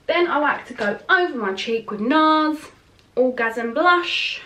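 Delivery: a light hint of a glossy advert read; put-on presenter voice. Naming her blush for viewers, a lightly hammed-up advert voice. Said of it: "I don't know why I'm talking like that".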